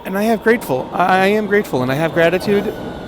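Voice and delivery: loud, high, proclaiming shout. Real, not acted. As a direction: belt it out with joy. Shouting gratitude on the train.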